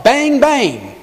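playful high bang bang; mock yell. Shown as a demonstration. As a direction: call it out like a kid's war game. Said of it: "and yell".

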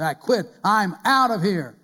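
mock storming-out declaration; defiant resignation. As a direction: announce it forcefully, stretching the last phrase. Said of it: "slam down your letter of resignation".

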